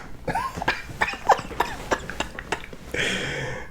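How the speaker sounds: long, high, wheezy-soft laughing fit; drawn-out quiet high laughter; real laughter. Real, not acted. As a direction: laugh helplessly at your own nonsense. Laughs at own nonsense.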